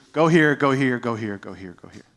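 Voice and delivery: low, fading murmur; voice in your ear. Retold or imitated. God speaking directions into your ear, low imitated voice. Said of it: "God in your ear just speaking to you".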